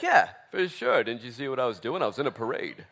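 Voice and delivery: laughing, mock-smug boast; mock bragging. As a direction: send up a bragger. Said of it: "Nobody's gonna be like".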